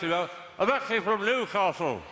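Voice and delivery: slow, as if through a mouthful of cake; mouth full. Real, not acted. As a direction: speak round a bite of cake. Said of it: "It's just what cake does to my accent".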